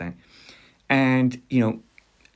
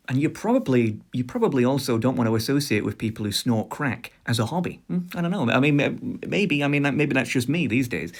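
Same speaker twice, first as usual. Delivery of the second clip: ordinary talk, nothing marked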